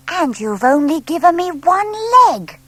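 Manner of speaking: high, slow, hurt little voice; a small owl feeling cheated; lightly voiced owlet protest; complaining owlet. A narrator's reading. Plop complains about drawing.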